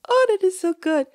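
high, loud glee; delighted. Real, not acted. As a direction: gush happily, pitched right up. Delighted with donkey and chest.

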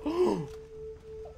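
sudden high gasp of alarm; sharp gasp. Real, not acted. Streamer startled mid-glide, gasps in alarm. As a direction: catch the breath in a startled gasp. Jump scare while gliding.

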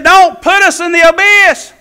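high, loud pleading cry; acted desperate wail; demons begging. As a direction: beg at full voice to be spared.

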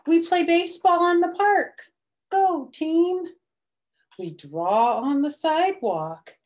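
bright, lively picture-book reading; animated storybook narration; reading to children. A narrator's reading. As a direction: read it out to little kids with energy.

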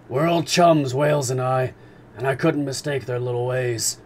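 a proud sailor bragging about whales; gruff harpooner. Acted. Ned Land boasting to companions.

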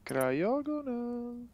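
high, very slow drawn-out call; drawn-out singsong. Pretend. Player announcing the card Cryogonal, drawn-out singsong. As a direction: stretch the name out high like a chant.

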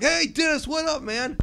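high, loud, casual greeting; Vinyl Scratch voice. Retold or imitated. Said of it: "she'd be like".

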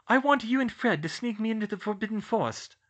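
put-on high, playful girl voice; young woman voice.

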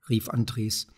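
plain reading voice

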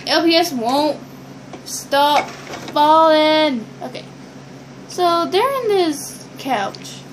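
normal talking voice

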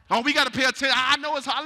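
normal talking voice

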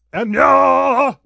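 gleeful shouted meow; big meow finale. Acted. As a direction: cap the chant with a yell. Ends meow chant on placing.

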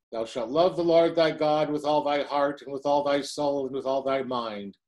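ordinary delivery

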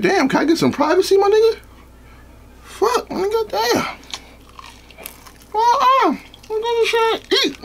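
falsetto whine; high squeaky voice. Pretend. Mock plea for privacy while eating.

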